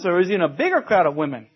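ordinary talk

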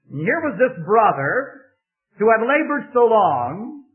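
impassioned preacher building to a climax; preaching at full force. Acted. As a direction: drive the point home to the congregation. Sermon rises to full preaching.

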